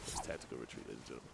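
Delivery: low, soft announcer aside; mock solemn announcement. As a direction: announce it gravely, very low and quiet.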